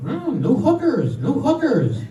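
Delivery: high, slow parrot squawk; talking parrot. Acted. Parrot looks around its new home, squawking. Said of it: "the parrot looks over at the daughters and says".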